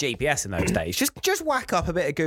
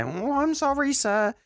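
squeaky sorry, much higher; a timid high-pitched apology; high apologetic voice; slower than usual. Retold or imitated. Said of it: "whose job is it to say".

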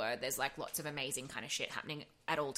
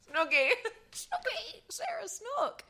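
squealed name, much higher; mock squeal; slower than usual. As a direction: squeak the name in delighted disbelief. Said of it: "good double for Snooki".

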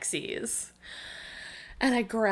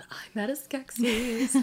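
whisper; 3 semitones higher, 3 dB quieter than usual. Retold or imitated. Hushed awed quote.

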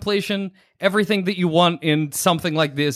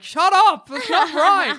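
mock angry yell; 11 semitones higher, slower than usual. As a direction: yell back at the radio, hammed-up. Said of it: "I'm like".

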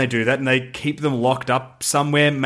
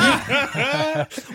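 high laugh; 12 semitones higher than usual. Real, not acted. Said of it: "Why is the alien British?".

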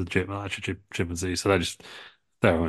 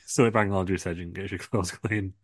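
ordinary talk, nothing marked

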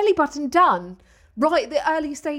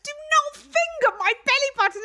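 disgusted; 11 semitones higher than usual. Real, not acted. Disgusted protest, high.

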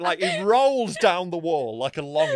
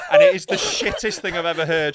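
ordinary delivery

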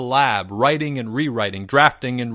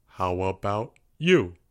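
lower, softer monotone question; dull monotone; 5 semitones lower, 4 dB quieter than usual. A narrator's reading. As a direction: ask it with no interest. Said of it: "spoke in a dull monotone".

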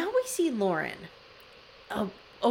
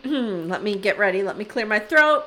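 ordinary talk

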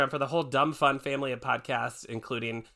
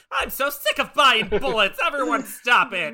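mild mock rant, higher and louder; mock angry governor; 11 semitones higher, 7 dB louder than usual. Retelling the governor's musical rage. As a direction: whine it like a cartoon governor. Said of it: "he's just like".

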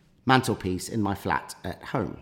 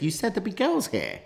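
much higher, held-up mock voice; high card voice; 11 semitones higher than usual. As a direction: blurt the incriminating line up high. Reenacts the card going off.

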